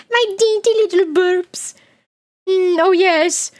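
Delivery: high, mock-English daintiness; dainty English accent. Streamer excusing their own burps, dainty English accent. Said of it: "everything she said sounded smart and dainty".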